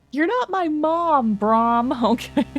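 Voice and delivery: teen snapping back at old Brom, hammed-up; sulky teen. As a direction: drag it out like a pouting teenager. Sulky boy line.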